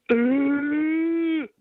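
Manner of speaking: high, drawn-out wheeze; noisy breathing. Shown as a demonstration. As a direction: breathe heavily like a dirty caller. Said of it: "is this a dirty phone call".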